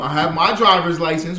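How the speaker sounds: normal talking voice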